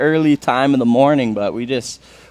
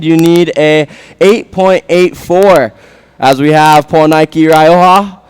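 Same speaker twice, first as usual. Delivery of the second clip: loud high score announcement; laid-back surfer announcer; 5 semitones higher, 9 dB louder than usual. Real, not acted. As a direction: call the scores over the PA. Announces score update over PA.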